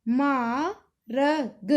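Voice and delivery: very slow, letters pulled apart; slowed, sound by sound. Shown as a demonstration. Teacher spelling a word for a pupil, very slow. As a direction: stretch each letter out for the learner. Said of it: "हमने लिखना मा".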